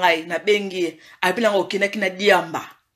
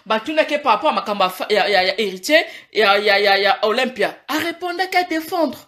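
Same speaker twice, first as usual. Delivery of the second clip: ordinary talk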